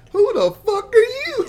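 mock-startled yelp; shocked outburst. Retelling Ned and MJ seeing Tobey Maguire arrive, shocked and laughing.

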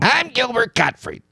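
high, weak screechy try; Gilbert Gottfried. Retold or imitated. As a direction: attempt a squawky comic voice. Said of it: "See, I can't do it".

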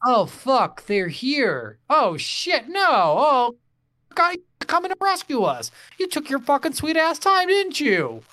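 high, loud mock indignation; exasperated outcry. Player scolding late rescuers in the game, hammed-up exasperated outcry.

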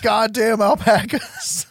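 high, drawn-out comic grumble; hammed-up slow complaint; mock exasperation. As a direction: curse them in a high, laughing whine. Mimicking dad mad about alpacas.

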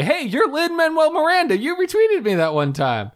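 very high squealing fan greeting; hammed-up excited fan; mock starstruck fan. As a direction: gush squeakily, easing down at the end. Acting out fan approaching Brad Pitt.

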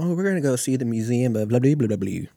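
airy bragging about the trip, lightly hammed; mock traveler. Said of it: "They start telling you".

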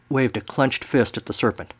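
ordinary delivery, nothing marked

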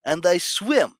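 put-on lightly done Russian accent, broken English; a hint of Russian accent for the zoo guy; mock Russian accent. Friend retelling a zoo video, in a light mock Russian accent. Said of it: "Well, he's Russian, so he's like".

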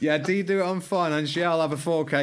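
eager shopper. Pretend. Acts himself buying on finance.

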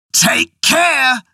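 high, loud, angry cry; enraged warning. Acted. Enraged husband warns his stubborn wife, high and loud. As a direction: bark the warning at her. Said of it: "cried her husband, enraged".

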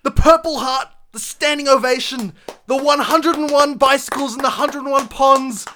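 hammed-up grand proclamation, cracking at the end; over-the-top dramatic declaration. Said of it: "he's about to bust a lung".

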